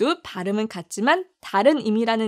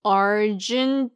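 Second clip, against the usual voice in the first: Argentina said very slowly, every syllable clear; teacher's slow repeat-after-me; slow model word; much slower than usual. Shown as a demonstration. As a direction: stretch out Argentina for learners to copy. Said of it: "그럼 같이 발음해 볼까요".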